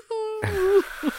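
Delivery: high, long imitated howl; high-pitched mock werewolf howl; wolf howl imitation. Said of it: "Like that?".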